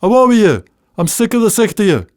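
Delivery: loud, high Bathgate shout, fast at the end; shouted Scots scolding. Retold or imitated. Mother shouting son outside to play, in Bathgate Scots. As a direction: chase him out the door in broad Scots. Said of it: "Billy would be told to go outside".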